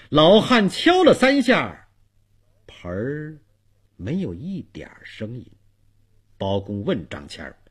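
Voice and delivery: usual speaking voice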